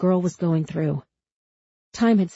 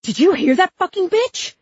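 very high, loud screamed question; outraged scream; 13 semitones higher, 6 dB louder than usual. A narrator's reading. Cop to Huck about the girl, outraged scream. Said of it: "the cop screamed".

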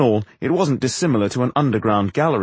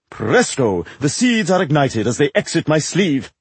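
higher, affected theatrical voice; proud showman actor; 4 semitones higher than usual. Acted. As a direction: show off the secret of the trick. Actor demonstrates his trick.

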